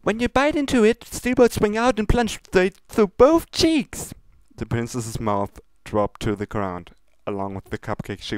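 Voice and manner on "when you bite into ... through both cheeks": high, bubbly pony voice, pleading; sweet baker begging her not to waste cupcakes; high Pinkie Pie voice. Acted. Pinkie pleads with Princess Luna, high and bubbly. Pinkie cheerfully describing gore.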